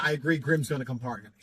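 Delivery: ordinary talk, nothing marked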